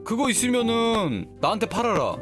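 ordinary talk

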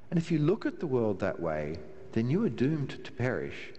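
ordinary talk

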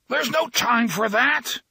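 high, drawn-out urgent cry; urgent yell pitched far up; straining scream. A narrator's reading. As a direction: cry out in desperate protest. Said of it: "he was trying to scream".

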